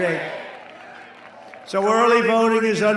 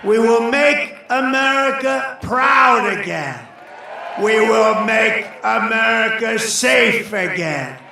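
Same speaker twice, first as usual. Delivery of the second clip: shouted rally refrain, rising and slower; rally speech; 3 semitones higher, 3 dB louder than usual. Real, not acted. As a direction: roar each line at the crowd.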